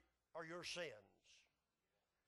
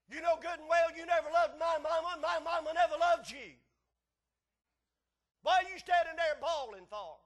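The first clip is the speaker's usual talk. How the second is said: loud, high-pitched angry tirade; acted wife yelling at her husband; irritated wife; 11 semitones higher, 14 dB louder than usual. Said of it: "of course it irritated his wife".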